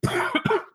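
sudden cough mid-sentence; coughing fit. Real, not acted. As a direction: cough breaks the word. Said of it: "That's the first time I've coughed".

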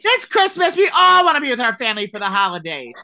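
screamed promo impression; Jenny. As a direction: belt out the ad at a high pitch, easing slightly. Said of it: "why is she screaming so loud".

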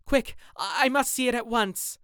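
desperate to go now; frantic shout. Acted. Dorian frantically urges the gamekeeper, shouting.

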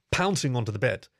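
usual speaking voice

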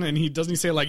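normal talking voice

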